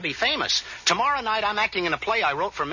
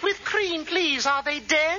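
high-pitched mock English lady; nonchalant English wife; 7 semitones higher, slower than usual. Joker mimics the film hero's wife replying, high nonchalant English voice. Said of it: "And she says".

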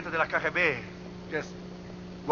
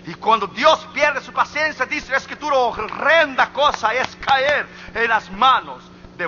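high, loud scriptural warning; forceful preaching; 8 semitones higher, 7 dB louder than usual. Real, not acted. Preacher warns of God losing patience, forceful preaching. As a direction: thunder the warning out to the congregation.

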